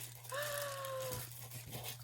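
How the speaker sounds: sharp high gasp of delight; gasp of awe. Real, not acted. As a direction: gasp as if seeing something precious. Opening gift, overcome.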